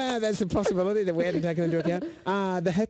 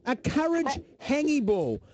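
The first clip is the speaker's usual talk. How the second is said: courage hangy ball drawn out slowly; slow, savouring; 6 semitones higher, slower than usual. Shown as a demonstration. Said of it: "repeating the odd word".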